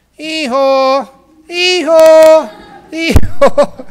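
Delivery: loud high hee-haw bray; a donkey crying out for help from a well. Acted. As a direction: bray like a stuck donkey calling out. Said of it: "He started shouting for help".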